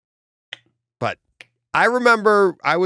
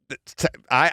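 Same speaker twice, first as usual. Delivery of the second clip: ordinary talk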